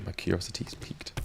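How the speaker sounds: whispered, secretive; hushed intrigued whisper. Real, not acted. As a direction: lean in and breathe it. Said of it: "repeated as a whisper".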